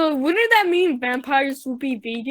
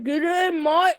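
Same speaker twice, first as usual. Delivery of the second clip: put-on Australian accent; 5 semitones higher, slower than usual. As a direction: shout it like an outback Aussie bloke. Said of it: "I'm gonna try to do an Australian accent".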